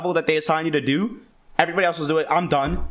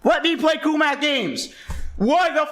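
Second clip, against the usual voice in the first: higher, pleading angry protest; pissed-off kid; 7 semitones higher than usual. Retold or imitated. Kid protesting to the teacher, higher and angry. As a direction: whine it like a mad ten-year-old. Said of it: "And I was pissed".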